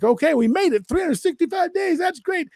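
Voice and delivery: very high, squeaky-pitched relief; mock celebration. Walker telling his friend they made a year, high-pitched celebrating. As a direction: celebrate in a high voice, ready to stop. Retold relief at reaching the goal.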